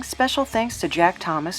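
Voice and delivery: usual speaking voice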